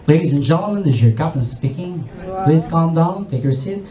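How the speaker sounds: steady the passengers with a low calm voice; calm captain. Acted. Captain calming panicking passengers, low and composed.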